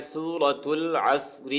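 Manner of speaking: slow, drawn-out سورۃ العصر with each letter clear; careful title reading. Shown as a demonstration. Teacher reading the surah name for students, slow and careful. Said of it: "اس کا نام بھی ہم، آ، درست کر لیتے ہیں".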